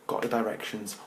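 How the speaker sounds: usual speaking voice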